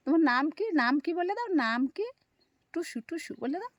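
high, loud coaxing; coaxing the bird. Real, not acted. Owner asks Tusu the myna its name, high coaxing.

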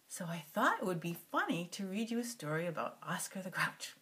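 soft unvoiced whisper. Real, not acted. Whispering to the grandkids about the story. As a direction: breathe it like a secret.

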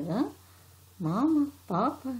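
ordinary delivery

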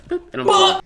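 boop sung out very high; imitated beep. Shown as a demonstration. Explaining the mechanism to viewers, a high chirped boop. Mimicking the machine sound.

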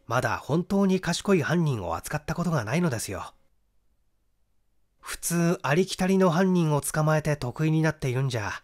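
usual speaking voice

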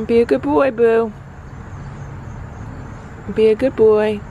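sweet goodbye to the cat. Real, not acted. Leaving cat Boo for the day, high cooing baby talk.

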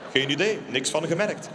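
ordinary talk

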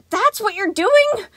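very high, loud, slow outraged question; dismayed yell. A narrator's reading. Sun yelling at Gregory in dread. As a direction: yell in disbelief. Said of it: "Sun yelled".

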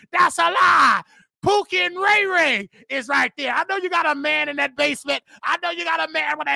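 high, insistent accusing shout. Real, not acted. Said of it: "shouting, that fake shouting you be doing".

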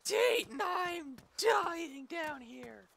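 high, slow melodramatic wail; mock dying wail. Attacked by zombie.

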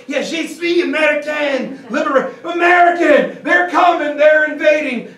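high, loud hammed-up yelling of the warning; mock frantic shout. Spy yelling to French soldiers that invaders come, mock panic shout. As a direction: blurt it out, panicking, in bad French.

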